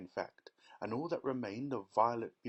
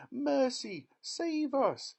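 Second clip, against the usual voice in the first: much higher, louder cry for help; panicked yell; 6 dB louder, much slower than usual. A narrator's reading. Mother panicking over daughter, shrill yell. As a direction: yell for rescue. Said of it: "yelled Missus Beauregard".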